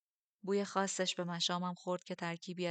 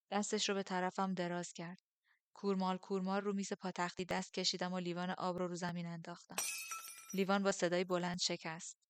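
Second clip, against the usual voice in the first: ordinary delivery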